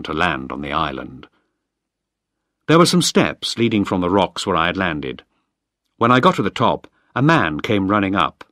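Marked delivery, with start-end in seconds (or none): none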